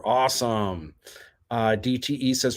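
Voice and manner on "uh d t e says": usual speaking voice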